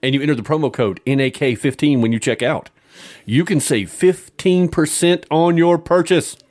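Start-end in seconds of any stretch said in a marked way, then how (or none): 3.3-6.4 loud announcement. Acted. Big sponsor discount reveal.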